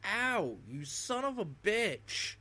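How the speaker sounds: high, loud hammed-up outrage; exaggerated indignant shout, high and slow; mock outraged yell. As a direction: yell like an offended commenter, drawn out. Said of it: "someone inside the comments with a freaking".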